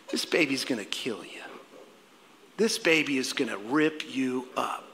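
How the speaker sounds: low, hushed, acted warning; ominous warning. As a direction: break bad news softly and low. Stranger's grim warning to a mother.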